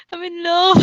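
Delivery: giggling while reading the line; can't hold the laugh in; laughing through it. Real, not acted. Reading cheesy line, cracking up.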